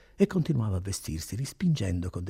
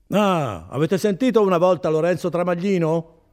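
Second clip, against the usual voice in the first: raised, high official's voice; loud, impatient; 7 semitones higher, 7 dB louder than usual. Acted. Notary rousing Renzo from bed, loud and impatient. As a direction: call out sharply to someone half asleep. Notary has shouted sleeper awake.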